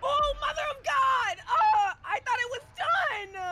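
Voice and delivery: loud, high cry of dismay; high, drawn-out tearful-sounding panic; panicked wail. Real, not acted. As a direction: shout it out, then wail slowly. Startled panic during horror game.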